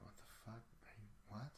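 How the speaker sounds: hushed, breathy muttering at himself; whispered self-doubt. Retold or imitated. Questioning himself while recording alone, in a whisper. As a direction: whisper it like your own inner critic.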